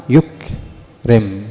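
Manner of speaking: يكرم broken very slowly as "yuk... rim"; hamza gone from يكرم. Shown as a demonstration. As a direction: pause after "yuk" in يكرم, no glottal stop. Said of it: "ذهبت الهمزة". (يكرم ببطء شديد، مقطعان بلا همزة)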